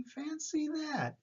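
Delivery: put-on high, slow, marveling old lady.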